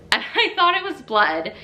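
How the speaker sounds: laughing, voice jumps high; laughing about thinking it was blood. Real, not acted. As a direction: giggle through the words. Laughing at the pee surprise.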